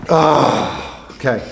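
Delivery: panting after trying shoes on; puffing for air; out of breath. Real, not acted. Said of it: "Little out of breath".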